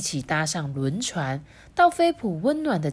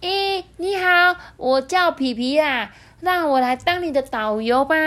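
high, loud, eager welcome; enthusiastic penguin; 9 semitones higher, 5 dB louder than usual. A narrator's reading. Penguin offers to be the guide, enthusiastic. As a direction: greet warmly in a high little penguin voice. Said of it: "热情地说".

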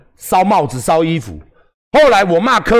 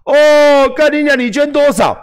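mock critic; 8 semitones higher, 4 dB louder than usual. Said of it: "你就要跟我讲说".

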